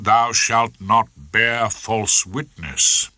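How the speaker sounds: slow, weighty biblical line; solemn scripture quote. Acted. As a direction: drag out each word for effect. Rumpole quoting scripture in court.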